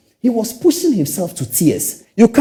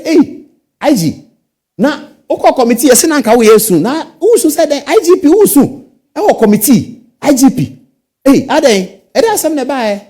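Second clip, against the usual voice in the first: loud, higher voice scolding the police chief; stern boss scolding; 8 semitones higher, 6 dB louder than usual. Pretend. Appointing authority calls the police chief in to scold him loudly. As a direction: demand an answer like an angry superior. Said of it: "I'll call him and say".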